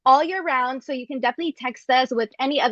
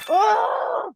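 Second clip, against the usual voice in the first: surprised; 9 semitones higher than usual. Real, not acted. Sour candy hits.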